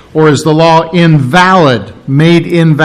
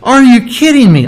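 incredulous; 7 semitones higher than usual. Retold or imitated. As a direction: ask it as if the idea were absurd. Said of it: "Do we nullify the law".

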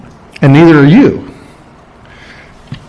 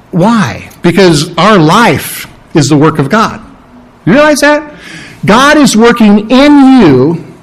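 normal talking voice

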